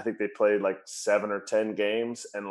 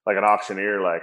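ordinary talk

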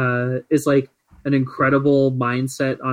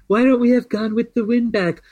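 Trump impression; 5 semitones higher than usual. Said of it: "he's like".

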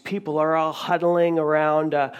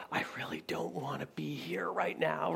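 very soft, awkward inner thought; uncomfortable muttered aside; 13 dB quieter than usual. Acted. Someone sharing a room with who let them down, uncomfortable undertone. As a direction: say it under your breath, ill at ease. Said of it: "that uncomfortable kind of".

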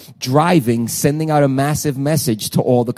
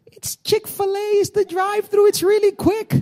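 mock wife voice. Pastor imitating his wife pitching the drive-thru, high sweet mock voice. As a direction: chirp it like his cheerful wife. Wife's voice retold.

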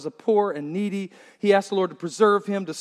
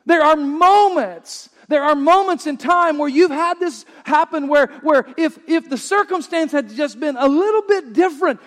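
preaching at full force; 7 semitones higher, 7 dB louder than usual. Acted.

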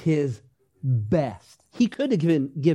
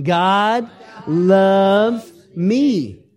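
very slow, louder, ringing declaration over music; with conviction; 3 semitones higher, 5 dB louder, much slower than usual. Shown as a demonstration. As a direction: proclaim it with full conviction. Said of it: "say it with some conviction".

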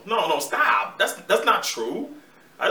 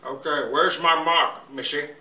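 Sean Connery impression; 3 semitones lower, 3 dB louder, slower than usual. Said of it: "Mister Connery".

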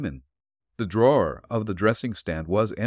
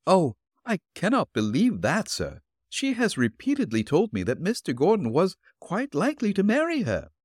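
put-on higher, gentle old woman's voice; elderly landlady voice; 9 semitones higher than usual. Landlady answering the detective at her door, politely.

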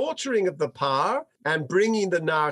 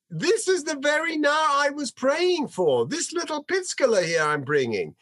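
proud exclamation; 9 semitones higher than usual. Acted. Hannah presenting her son.

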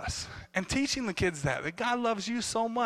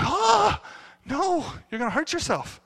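a sudden high loud Oh of alarm, then fretting; worried cry; 9 semitones higher, 3 dB louder than usual. Retold or imitated. Dad cries out as his daughter flips off the board, alarmed. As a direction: gasp it out like a dad seeing his kid flip. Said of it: "I started getting worried".

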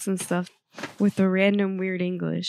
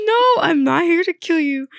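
laughing reassurance; 7 semitones higher, 6 dB louder than usual. Retold or imitated. Nurse reassuring a confused patient.

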